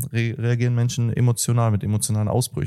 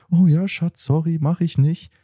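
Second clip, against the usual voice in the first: higher, eager to please; meek apology; 6 semitones higher than usual. Retold or imitated. Partner quoted as meekly giving in.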